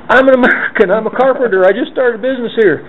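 high, loud, desperate protest to the cops; frantic pleading. Retold or imitated. Bent over a car, pleading high and frantic to cops. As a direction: rattle it off like your life depends on it. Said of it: "and I'm saying".

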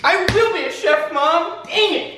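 whiny meltdown. Acted. Chef breaks down, calls for Mom.

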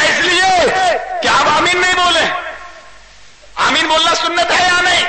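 high, insistent arguing; heated challenge. Acted. Worshipper challenges imam on sunnah of amen, heated.

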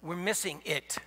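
usual speaking voice

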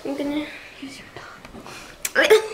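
slow playful whisper. Real, not acted. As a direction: whisper it slowly, teasing. Joking threat to sister.

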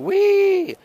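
a sudden high sledding whoop; gleeful whee. Acted. Streamer recalls his sledding for viewers with a high gleeful whee. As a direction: squeal it like flying downhill on a sled.

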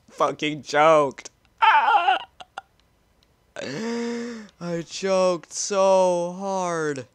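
slow, rueful laughing; laughing at himself. Real, not acted. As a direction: laugh off the choke. Laughing at own failure.

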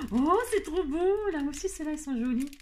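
high, thrilled gush over the gift; delighted squeal. Real, not acted. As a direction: squeal with delight at something cute.

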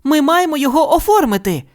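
high, earnest insistence; Judy insisting. A narrator's reading. Judy insists to Milton on filing evidence, earnest. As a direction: hold your ground.

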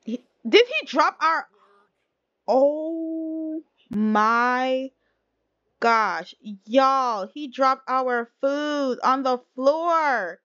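high, drawn-out dismay; shocked disbelief. Real, not acted. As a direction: gasp it out, stretching the words in outrage. Waiter drops the food in game.

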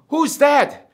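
acted jolt of surprise; startled. Man on branch hears unknown voice, acted startled cry.

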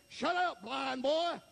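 high, sharp acted rebuke; mock scolding cry; scolding crowd. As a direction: silence him like a heckling crowd. Crowd silences the beggar.

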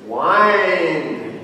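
normal talking voice

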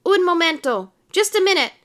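flustered, high-pitched appeal; crying out. A narrator's reading. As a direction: beg the crowd for a pause. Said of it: "he cried".

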